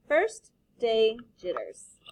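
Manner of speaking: slow, deliberate book title; slow title read. Shown as a demonstration. Teacher announcing the book title, slow and deliberate. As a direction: savour each word of the title. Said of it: "announcing the book title".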